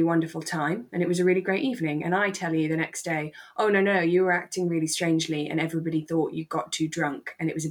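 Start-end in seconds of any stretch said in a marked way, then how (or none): none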